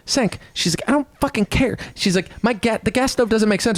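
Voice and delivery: high, exasperated mock female rant; mock Anna yelling at Cenk; Anna impression. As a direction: play her up high and fed up. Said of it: "I'm doing a Anna impression".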